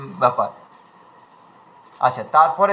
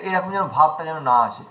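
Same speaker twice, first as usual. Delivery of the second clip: ordinary talk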